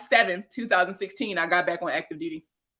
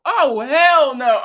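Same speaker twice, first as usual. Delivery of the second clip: laughing shout; 5 semitones higher, 9 dB louder, much slower than usual. Real, not acted. Reacting to a very young nurse.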